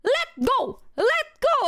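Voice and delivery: very high, shrieking orders to let go; retold panicked yell. Friends yelling at the man dragging her, high shrieking shout. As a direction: scream it like a terrified friend. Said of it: "so they're like".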